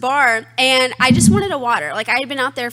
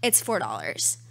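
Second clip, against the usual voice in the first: deadpan bartender; 14 semitones lower, 9 dB quieter than usual. Retold or imitated. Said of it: "she was dead ass serious".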